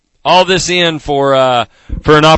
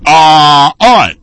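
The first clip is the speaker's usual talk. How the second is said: loud, raised, punchy interjection; loud alpha; 5 dB louder than usual. Shown as a demonstration. Host shows how to dominate the conversation, loud alpha. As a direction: take over the conversation, loudly. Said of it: "you gotta be loud".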